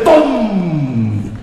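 imitated crash boom. Crash sound effect.

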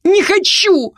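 put-on very high girlish shriek; crying out. A narrator's reading. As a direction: shriek it in protest. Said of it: "выкрикнула Рози". (пронзительный протестующий выкрик)

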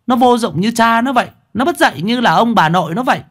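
mother ranting at the neighbour about her son; contemptuous mother. A narrator's reading. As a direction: dismiss the child like trash.

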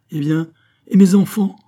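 loud, high cry of alarm; exclaimed protest. A narrator's reading. Queen pleads for her children before the king, exclaimed protest. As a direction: burst out in anguished challenge. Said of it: "s'écria la reine".

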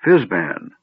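ordinary delivery

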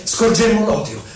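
usual speaking voice

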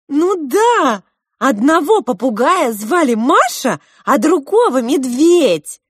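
high, loud, eager outburst; excited explanation. A narrator's reading. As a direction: announce the names excitedly. Маринка радостно называет имена. (громко и восторженно)

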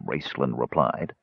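plain reading voice

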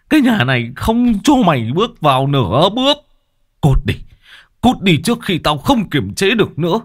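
high, furious outburst; furious scolding. Acted. Brother-in-law barring her from the house, furious scolding. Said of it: "Này thấy ông Toàn chửi mắng".

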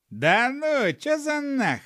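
high, loud protest; loud, high-voiced disbelief; indignant gnome. A narrator's reading. Startled gnome protests, throws up hands.

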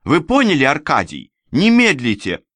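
loud, high, pressing command; urgent order. A narrator's reading. Companion dispatching the false deacon, urgent order. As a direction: urge him to act without delay.

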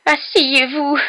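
loud high elf wail. A narrator's reading. Elf overwhelmed at being offered a seat, wailing. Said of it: "gémit la créature".

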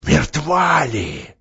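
slow, eerie strange voice; grey dreadful voice. Acted. Werewolf to the doctor, slow eerie. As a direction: chill them with doubt. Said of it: "Тот серый ужасный голос".

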